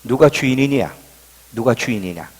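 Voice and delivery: quiet, low voice of God; understated divine question. Retold or imitated. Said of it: "하나님의 첫 질문이".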